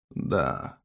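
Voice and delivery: faint low croak; weak dying voice. Acted. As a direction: answer like a dying man. Dying witness answers questions. (слабый голос умирающего)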